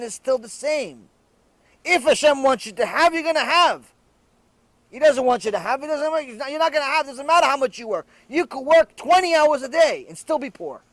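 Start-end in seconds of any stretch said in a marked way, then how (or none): 1.8-10.8 louder, insistent preaching; raised sermon voice, pressing each line. Acted. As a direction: drive the point home to the congregation. Preaching the lesson.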